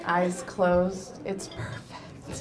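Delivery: slow, hushed admiring verdict, fading to a whisper; doctor's slow praise. Retold or imitated. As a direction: savor each word like a proud doctor. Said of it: "she says this to me".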